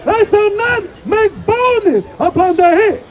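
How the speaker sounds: high, loud, slow street-preacher scripture call; booming scripture proclamation; shouted scripture reading. Shown as a demonstration. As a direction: proclaim the verse to the whole street. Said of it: "Read it again".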